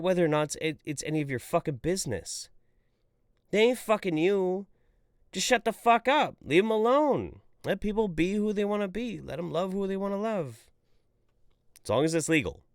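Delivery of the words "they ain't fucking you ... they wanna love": higher, louder heated rant; heated defense. Real, not acted. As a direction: defend them hard.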